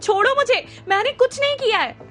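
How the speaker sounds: desperate shout while being grabbed. Acted. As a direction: struggle and cry out. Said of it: "Avin जोर से चिल्लाया".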